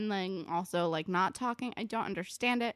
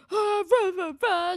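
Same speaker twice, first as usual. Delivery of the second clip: loud, high mock raging noises; hammed-up angry roaring, pitched way up; super aggro; 11 semitones higher, 9 dB louder than usual. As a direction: spoof an aggressive rant with shouted nonsense. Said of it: "super aggro".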